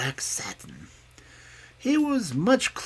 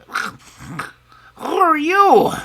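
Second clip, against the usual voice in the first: high, much louder, very slow startled cry; frightened cry; 10 dB louder, much slower than usual. A narrator's reading. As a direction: jolt awake in fright. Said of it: "cried Brownie".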